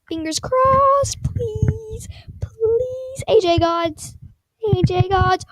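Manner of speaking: high, drawn-out excited begging; desperate pleading. Real, not acted. Streamer begging the A J Gods, desperate pleading. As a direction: plead upward, stretching out the words.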